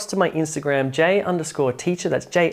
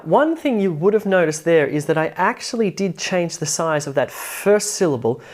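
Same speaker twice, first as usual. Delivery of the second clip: normal talking voice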